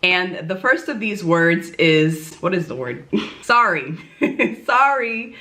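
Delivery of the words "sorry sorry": amused, giggly delivery; laughing. Real, not acted. Vlogger finally finds the word, laughing. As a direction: say it through a laugh.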